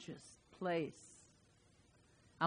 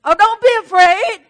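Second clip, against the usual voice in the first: much higher, loud reassuring proclamation; Moses's call; 11 semitones higher, 18 dB louder than usual. Acted. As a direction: call out to calm the crowd. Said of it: "And Moses said to the people".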